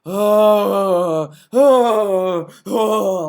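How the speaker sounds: hammed-up whiny moans; mock diva moans. Mocking Phil's moaning while he played. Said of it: "he had these diva moans".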